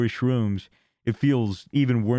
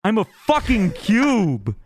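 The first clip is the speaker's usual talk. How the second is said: imitating a stoned friend, high and drawn out; tripping friend freaking out; 6 semitones higher, 4 dB louder, much slower than usual. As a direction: stretch it out in high-pitched disbelief.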